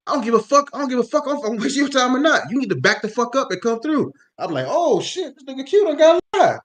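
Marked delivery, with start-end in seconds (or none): 0.1-4.1 high, loud angry outburst; retelling Q losing his temper; coworker snapping. Retold or imitated. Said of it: "Q fucking snapped". 4.7-6.6 high, loud astonishment; a hyped mock shock; shocked glee. His excited reaction retold.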